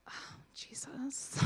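slow breathy whisper; a slow awed whisper; whispered oh Jesus. Real, not acted. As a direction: whisper it, overwhelmed. Moved by woman's thanks.

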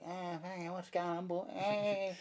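put-on Aussie guy accent. Comic mocks how his Aussie voice sounds among foreigners, broad high Aussie accent. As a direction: send up your own Aussie accent, pitched high. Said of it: "this Aussie guy, like".

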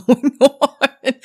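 breaking into a laugh mid-sentence. Real, not acted. Speaker admits confusion at Harry Potter Land, laughing through it. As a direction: let a real laugh spill into the last words. Amused at her own confusion.

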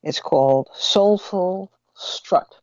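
normal talking voice

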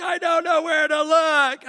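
put-on high, wailing little-boy shout; mock tearful wail of frustration; crying child. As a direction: imitate a kid crying out in despair. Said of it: "So then he starts crying".

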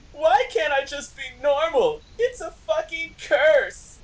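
very high melodramatic wail; shouting. A narrator's reading. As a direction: shout it as an anguished teen. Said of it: "I shouted".

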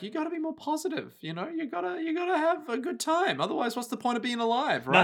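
very high, soft cartoon pep talk; mock-perky Utena. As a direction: chirp it in a tiny high voice, imitating her. Acting upbeat Utena to gloomy friend.

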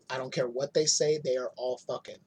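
normal talking voice

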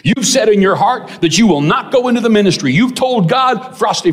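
high, emphatic imitation of a prophesying preacher; confronting preacher. Preacher telling the young man his secret vow, confronting. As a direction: declare it forcefully, pitch well up.

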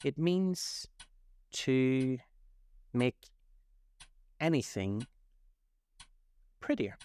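halting, word by word, with long pauses; doubtful. A narrator's reading. Alice unsure as she defines a word for the Griffin, doubtful. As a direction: feel your way uncertainly through each word. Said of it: "said Alice doubtfully".

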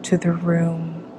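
calm meditation guide with long pauses; unhurried, measured visualization voice; guided meditation. Acted. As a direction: lead them into relaxation, phrase by phrase.

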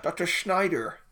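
hint of a film character calling a name; Indiana Jones impression. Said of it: "Like Indiana Jones, where they go".

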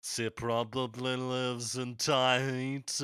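slow "she probably lives in Tahiti" with Tahiti as "tie-hiti". Retold or imitated. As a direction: sing it slowly, Tahiti almost "tie-hiti". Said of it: "it's almost an I".

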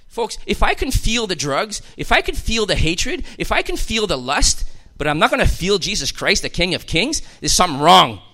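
loud, rising preacher's build; fiery preaching. Acted. Preacher crying out to his flock, loud fervent preaching. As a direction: drive it home to the congregation.

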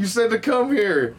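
high, hammed-up defiance; mock defiant Kenny. Kenny defying the blackmailers, high mock anger.

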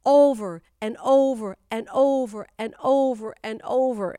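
"over and over" in a loud, steady rhythmic loop; "over" hammered out like a repeated recitation; rhythmic repetition. Shown as a demonstration. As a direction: punch each "over" loudly, evenly repeated. Mimics endlessly repeated recitation of verse.

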